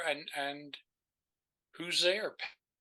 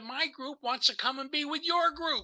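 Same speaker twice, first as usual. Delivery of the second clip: bright retold invitation; 11 semitones higher than usual. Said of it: "she said".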